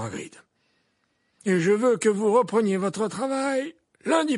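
put-on high, loud old wizard voice; gently probing old headmaster; Dumbledore voice. A narrator's reading.

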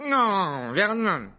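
loud, high interruption; hiccuping tipsy objection. A narrator's reading. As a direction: cut the uncle off loudly. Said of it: "octa la tante Marge en levant la main". (refus sonore et haut perché)

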